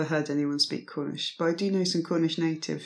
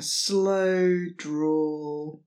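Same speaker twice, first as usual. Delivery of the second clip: slow drawl. Shown as a demonstration. Describing Cornish drawl.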